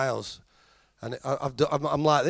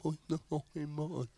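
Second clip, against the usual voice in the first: mumbled tannoy noise; 15 dB quieter than usual. Retold or imitated.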